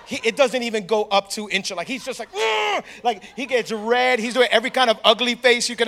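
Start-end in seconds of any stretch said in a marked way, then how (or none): 2.3-2.8 high, drawn-out mock strain; imitated straining grunt. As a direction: push against an immovable weight. Imitating friend straining under bar.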